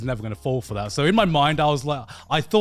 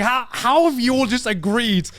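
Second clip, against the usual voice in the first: disbelief; 5 semitones higher than usual. Retold or imitated. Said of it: "And I was just like".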